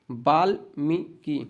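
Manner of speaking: Valmiki in three separated syllables; careful teacher's slow repeat; slow dictation. Shown as a demonstration. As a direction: say it piece by piece, slowly. Dictation, word repeated slowly.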